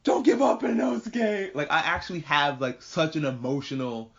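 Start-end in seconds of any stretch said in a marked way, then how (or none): 0.0-1.5 screaming at screen. Retold or imitated. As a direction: yell desperate encouragement at the screen. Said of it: "I was screaming at the screen".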